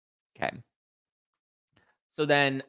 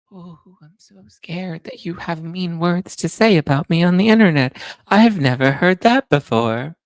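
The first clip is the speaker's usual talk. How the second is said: high, loud mock fear; mock the haters with fake fright; sarcastic mockery; 7 semitones higher, 9 dB louder than usual. Real, not acted. Taunting Reddit haters, loud and sarcastic.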